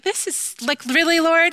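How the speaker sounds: high, loud, incredulous plea to the sky; mock exasperated. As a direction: complain to God with comic disbelief. Mock complaint to God.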